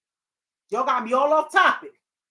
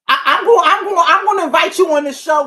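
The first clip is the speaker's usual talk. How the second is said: louder, eager call-out to a guest; excited invitation; 3 semitones higher, 10 dB louder, faster than usual. Real, not acted. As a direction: shout the invite with real excitement.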